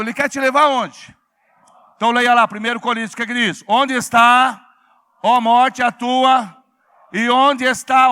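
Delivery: loud, rousing preacher calling out to the church; full-force preaching. Acted. Pastor rousing the congregation, loud fervent preaching. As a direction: drive it home to the congregation, loud.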